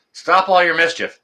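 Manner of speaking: ordinary delivery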